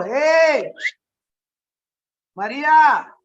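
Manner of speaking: high, loud cheerful hey to a friend; Jesus calling Mary by name, loud and playful. Retold or imitated. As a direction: call her name out brightly.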